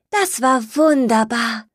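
high, content little skunk voice; happy little skunk sigh. Acted. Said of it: "seufzte das kleine Stinktier glücklich".

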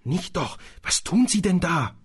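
hissed protest. Acted. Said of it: "zischte Harry".

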